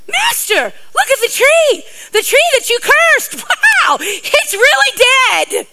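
excited shout. Retold or imitated. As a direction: point at the dead tree in wonder. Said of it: "He goes".

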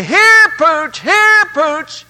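high, drawn-out dog call. Acted. Man on the porch hollering for Pooch, calling high.

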